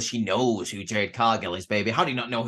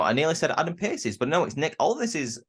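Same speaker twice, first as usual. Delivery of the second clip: usual speaking voice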